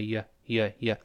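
"y" as a short, soft yə; you reduced to "y" with a schwa; weak vowel. Shown as a demonstration. Teacher shows reduced you, weak vowel. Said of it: "都发成元音弱化".

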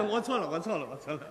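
mock contrite. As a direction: grovel quietly to the cop. Driver caught by police.

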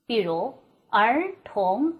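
儿童 slowed down, each syllable rising; second tone demo. Shown as a demonstration. Teacher slowly modeling the rising second tone. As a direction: stretch out 儿童 so both syllables climb. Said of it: "这四个音节的声调".

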